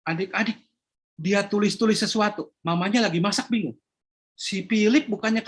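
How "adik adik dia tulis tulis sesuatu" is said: plain reading voice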